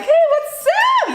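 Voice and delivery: very high, slow, sing-song hello; chirpy over-the-top hi; bubbly greeting. Shown as a demonstration. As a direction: greet a friend all sunny and upbeat. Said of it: "this is what you're expecting".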